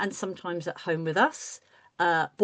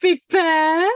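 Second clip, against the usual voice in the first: high, loud, delighted call of the name; excited greeting; 9 semitones higher, 9 dB louder than usual. Real, not acted. Greeting the puppet bear.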